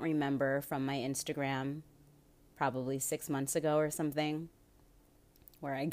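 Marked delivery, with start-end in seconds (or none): none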